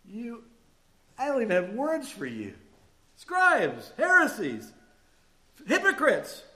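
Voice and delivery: rising to a loud, high denouncing shout; furious rebuke. Acted. Jesus rebuking the Pharisees, a loud furious shout. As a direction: hurl each name like an accusation.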